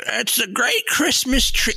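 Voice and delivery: put-on high, slow old man voice; old timer voice. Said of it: "his old timer voice".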